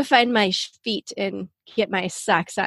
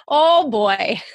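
Minnesotan accent. Shown as a demonstration. Host owning her giveaway accent, playful Minnesotan. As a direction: lean playfully into the Minnesotan vowels. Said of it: "especially when I say things like Minnesota".

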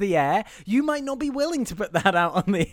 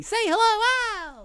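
very high, drawn-out show-host call; hammed-up cue. Host introduces Al to the listeners, hammed-up high call. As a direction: stretch it out high, cueing the co-host.

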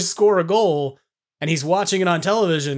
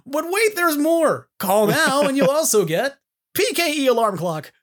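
"but wait, there's more" hype; infomercial; 8 semitones higher than usual. Pretend. Mock infomercial upsell.

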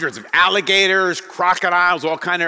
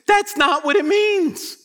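high, slow, hammered-out denial; emphatic rebuttal; 13 semitones higher, 3 dB louder, slower than usual. Real, not acted. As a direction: cut off the wrong idea, pitched way up. Overturning the common sad reading.

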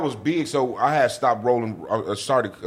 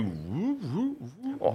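high, breathy-free light woo calls, a bit softer; mock coaster woos; 9 semitones higher, 9 dB quieter, much slower than usual. Guest showing the hosts his VR coaster ride, light high woos.

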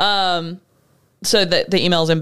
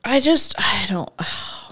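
whispering; slower than usual. Real, not acted. Exasperated reaction to email.

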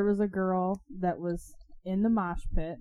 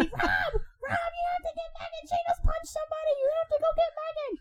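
very high-pitched mock scream for help; Kelsey's frantic call. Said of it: "I hear Kelsey".

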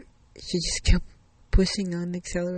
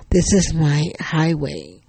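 loud, slow, emphatic declaration; emphatic assertion; 10 dB louder, slower than usual. Acted. Officer tells rescued driver about his highway, emphatic. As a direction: claim it firmly, voice well raised.

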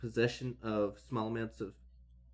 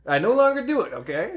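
mock denial; 10 semitones higher, 13 dB louder than usual. Mock denial about cocaine.

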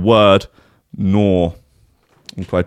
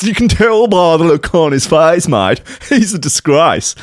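hammed-up Australian accent, pitched high; terrible Australian accent; 5 semitones higher than usual. Podcast host quoting the steer's owner, terrible Australian accent. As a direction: lay on a very broad bad Aussie accent. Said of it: "That's a terrible Australian accent".

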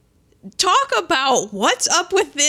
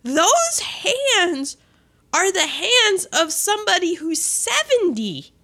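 high, hammed-up disbelief; aghast; 7 semitones higher than usual. Recounting to co-host shock at her hands, aghast. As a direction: swoop up in comic horror.

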